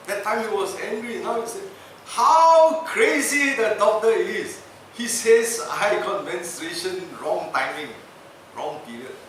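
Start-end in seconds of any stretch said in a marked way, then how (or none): 2.0-9.2 mocking scoff. Governor now laughing about it.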